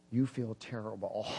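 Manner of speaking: low, quiet accusing taunt; devil's taunting voice. Retold or imitated. Enemy speaks discouragement to woman, low taunting. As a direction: needle them low and quiet.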